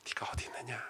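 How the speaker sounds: God's call whispered gently; hushed whisper. Shown as a demonstration. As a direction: breathe it out as a tender whisper. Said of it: "여러분, 이게 어떻게 들리세요?".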